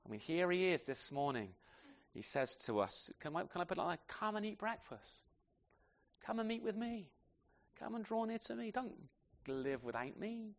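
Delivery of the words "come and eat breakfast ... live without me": tender invitation. Acted. Voicing the Lord's invitation today.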